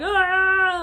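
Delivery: loud, high imitated yell; a wordless mock yell of customers losing it; mock angry outburst. Customers losing it on the two women, mock outburst. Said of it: "everybody likes to lose their shit".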